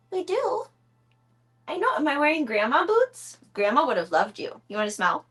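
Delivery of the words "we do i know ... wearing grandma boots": pet talk. Real, not acted. Coaxing her cat to take medicine in high baby talk.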